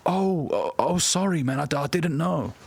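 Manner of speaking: high-pitched, stammering sorry; mock flustered apology. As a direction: imitate someone caught out, stumbling through an apology. Mimics people called out, apologising.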